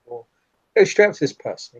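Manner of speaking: ordinary delivery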